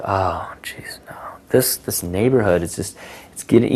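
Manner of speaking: whispered mock dismay; disappointed whisper. Shown as a demonstration. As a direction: hush it like bad news. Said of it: "show sheer disappointment".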